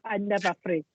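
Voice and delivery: lightly imitated African accent, softly; a hint of a quiet Wakandan accent; Chadwick Boseman.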